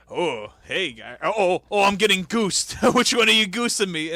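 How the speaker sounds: loud, high, flustered goosing reaction; hammed-up yelps of a man being goosed; Vince McMahon. Podcaster acting out Vince getting goosed, loud hammed-up impression. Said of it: "Vince McMahon going".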